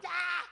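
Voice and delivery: high, loud yell as he runs; running yell. Retold or imitated. Jumping up from the pew, a high loud yell. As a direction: shout it out, bolting from the pew. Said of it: "I just ran and yelled".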